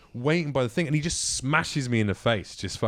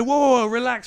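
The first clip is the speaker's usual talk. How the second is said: mock calming; 12 semitones higher, 7 dB louder than usual. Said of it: "And I said".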